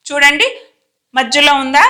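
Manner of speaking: ordinary delivery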